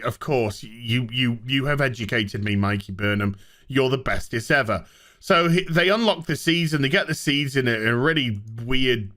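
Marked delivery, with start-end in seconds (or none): 0.0-4.8 mock fawning. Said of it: "he's just like".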